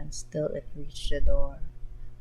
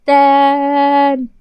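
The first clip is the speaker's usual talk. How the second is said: loud, high, sung-out call of the name; drawn-out call; 7 semitones higher, 10 dB louder than usual. Acted. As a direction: stretch the name out in a loud singsong. Gokudera calling out loudly.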